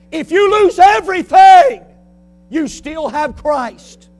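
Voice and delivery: loud, high, slowed climax; fiery preaching. Acted. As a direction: build to a cry, then land slowly. Preaching at full force.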